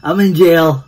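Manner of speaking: frustrated repeated outcry. Real, not acted. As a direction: hit "in" hard, like you can't believe it. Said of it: "I'm so pissed right now".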